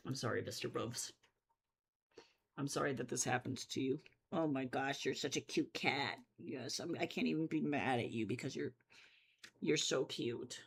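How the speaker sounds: cooing at the cat. Real, not acted.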